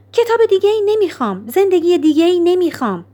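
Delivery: high-pitched, emphatic refusal; vehement refusal. A narrator's reading. Nora refuses another book or life from the librarian, vehement.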